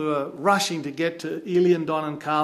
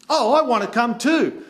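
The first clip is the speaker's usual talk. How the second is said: mock-eager friend, higher and louder; eager pleading; 7 semitones higher, 6 dB louder than usual. Friend begging to come over the fence, eager pleading. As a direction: pipe up like someone feeling left out.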